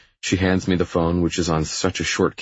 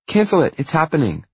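high, insistent order; pushy sister, pitch way up; bossy little sister; 12 semitones higher, 5 dB louder than usual. Acted. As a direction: overrule him in a high-pitched voice. Pushy sister overrides his refusal.

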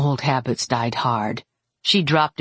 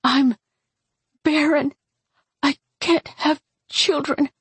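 high, halting, distressed voice; tearful confession; 11 semitones higher than usual. A narrator's reading. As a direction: confess it in a high voice, pausing between words. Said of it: "her dark eyes wide and tear-drenched".

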